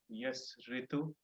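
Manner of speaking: usual speaking voice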